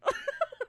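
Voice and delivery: high shrill cackling witch laugh; witch cackle. Retold or imitated. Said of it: "their laugh sounds like a witch laughing".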